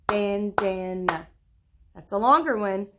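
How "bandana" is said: "Bandana" slowly in three separate beats; syllable by syllable. Shown as a demonstration. Teacher clapping with pupils, "Bandana" slow, one beat per syllable. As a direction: stretch and split "Bandana" into three clapped parts. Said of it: "let's say it and clap it".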